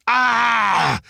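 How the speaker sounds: high, loud furious yell; enraged scream while hoisted; raging yell. A narrator's reading. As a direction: bellow in helpless rage. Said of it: "he raged".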